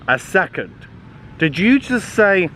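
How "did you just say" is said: plain reading voice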